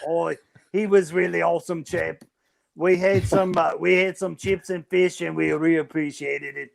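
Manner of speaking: hammed-up Australian Jesus; Australian accent. Jesus recalling welcoming Steve Irwin to heaven, Australian accent. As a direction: put on a loose Australian accent. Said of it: "I can do an Australian accent too".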